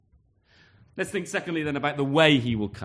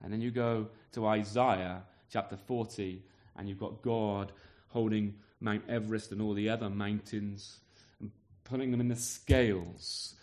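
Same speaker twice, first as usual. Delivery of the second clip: ordinary talk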